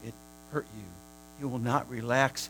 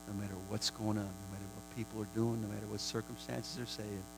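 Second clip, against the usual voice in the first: lower, much softer prayerful voice; 5 semitones lower, 9 dB quieter than usual. Acted. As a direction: pray quietly, resting in peace. Soft prayer over peace.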